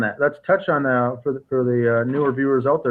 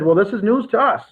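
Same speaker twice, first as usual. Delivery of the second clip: mild surprise; 4 semitones higher than usual. Retold or imitated. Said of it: "They said".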